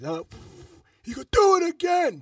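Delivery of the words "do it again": high loud barked order; retold shout. Cop to the driver at the breathalyzer, shouted order. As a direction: yell it in his face like an angry cop. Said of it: "me gritando en la cara".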